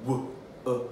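"Wo-, uh" as separate short sounds; soft, isolated phonemes "Wo-" and "uh"; sounding out. Shown as a demonstration. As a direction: segment "Wo-, uh" with gaps. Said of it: "time to sound out some words".

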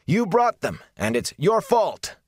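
high, loud accusing shout; angry villagers shouting; crowd shout. A narrator's reading. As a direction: yell the blame at her. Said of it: "there were shouts of".

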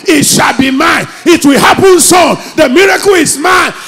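high, loud rapturous shouting; ecstatic confession. Retold or imitated. Woman shouting her confession, so crazy with joy. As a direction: proclaim it wildly, carried away. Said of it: "she started confessing".

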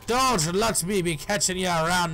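lively mock Irish lilt; put-on British-Irish accent. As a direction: keep up the hammy Irish accent. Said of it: "now I'm speaking in a British accent".

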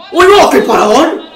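high loud cry at a save; gasp-yell at the save; shocked shout. Real, not acted. Great save.

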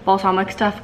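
normal talking voice

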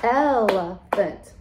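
ELEPHANT drawn out slowly in three beats; Elephant, slowly split into syllables. Shown as a demonstration. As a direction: stretch Elephant into el-e-phant, one beat at a time. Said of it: "Let's count them together".

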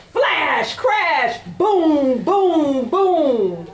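dramatic thunder refrain; thunder booms. Acted. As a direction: shout the storm noises.

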